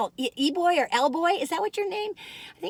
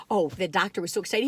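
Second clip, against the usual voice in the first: ordinary delivery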